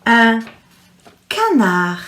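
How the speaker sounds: canard said as kana, with a silent D; French pronunciation. Shown as a demonstration. Teacher modelling un canard for children to repeat. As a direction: drop the D at the end of canard. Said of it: "the D will be silent as well".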